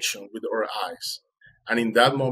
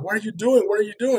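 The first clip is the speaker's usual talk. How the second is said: much higher, mildly louder retold yell; mock screaming; 10 semitones higher, 3 dB louder than usual. Fisherman to exhausted swimmer at the boat, mock screaming. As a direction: imitate a frantic man yelling. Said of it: "the guy started screaming at me".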